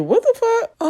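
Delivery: high squeaky shocked what the fuck; shocked disbelief. Retold or imitated. Jabari watching Zeke touch Carrie, shocked disbelief. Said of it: "Jabari is looking like".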